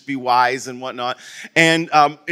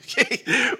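short laugh; 9 semitones higher, 4 dB quieter than usual. Real, not acted. Laughing at himself.

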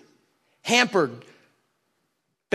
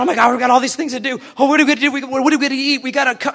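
fast, higher, frantic fluster; scatterbrained Martha; 5 semitones higher, 4 dB louder, much faster than usual. Retold or imitated. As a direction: rattle it off like a flustered hostess. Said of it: "Martha's, like, scatterbrained".

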